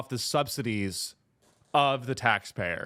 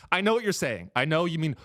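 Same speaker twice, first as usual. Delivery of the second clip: normal talking voice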